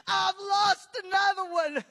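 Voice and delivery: loud grieving cry; anguished Jesus. Retold or imitated. Preacher crying out the heart of Jesus, anguished wail. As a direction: wail the loss out at full voice. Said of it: "I could feel his heart saying".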